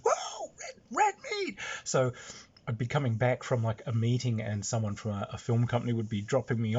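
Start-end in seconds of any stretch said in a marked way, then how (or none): 0.0-1.6 high, whooping gang greeting; high, whooping call of his nickname; excited greeting. Retold or imitated. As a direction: hail him happily across the street. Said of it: "every time I go past, it's".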